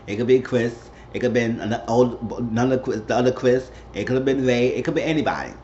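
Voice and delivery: ordinary talk, nothing marked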